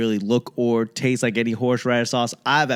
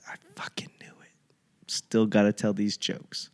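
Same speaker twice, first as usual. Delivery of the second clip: hushed unvoiced whisper to himself; whispered aside; 4 semitones lower, 6 dB quieter than usual. Comic whispering his own inner thought to himself.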